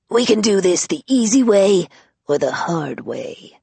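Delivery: lightly rasped menacing hiss; a hint of gravelly Batman threat. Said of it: "Vero hissed".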